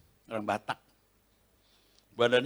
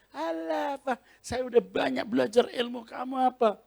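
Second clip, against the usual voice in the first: mocking taunt; 11 semitones higher than usual. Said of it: "Dia, dia ngeledekin saya".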